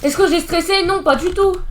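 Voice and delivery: usual speaking voice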